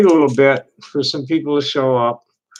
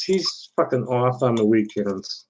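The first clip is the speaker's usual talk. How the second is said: usual speaking voice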